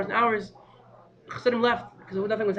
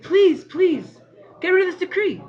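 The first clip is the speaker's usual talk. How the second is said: higher, louder, begging please please; pleading cry; 10 semitones higher, 7 dB louder, slower than usual. Retold or imitated. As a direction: plead desperately as if in tears. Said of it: "the Rebbe began to cry".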